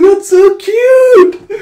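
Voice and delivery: gushing squeal. Acted. Audience gushing at the kitten puppet, high squeal. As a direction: melt over something adorable.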